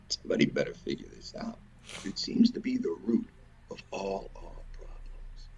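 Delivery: sudden hushed whisper, fast. Real, not acted. Confiding the root of all problems to viewers, whispered. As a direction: drop it to a breathy secret. Drops to whisper after outburst.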